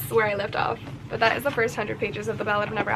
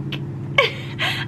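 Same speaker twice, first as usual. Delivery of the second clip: breathless laugh. Real, not acted. Laugh at the neck-licking scene.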